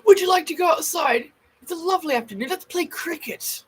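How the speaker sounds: high, fancy English invitation; genteel old-time British, drawing out the end; posh old British accent. Pretend. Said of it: "They used to talk like that".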